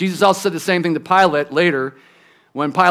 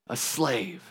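"A slave" repeated much softer and lower, sinking away; soft echo; 6 semitones lower, 12 dB quieter than usual. Shown as a demonstration. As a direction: let "A slave" fall quiet like a weighty echo. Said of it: "the word is a slave".

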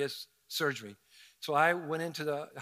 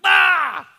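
very high, loud sudden shriek; startled fearful scream from a ladder; panicked scream; 14 semitones higher, 15 dB louder than usual. Retold or imitated. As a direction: shriek like a bee just surprised you on a ladder. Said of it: "just like".

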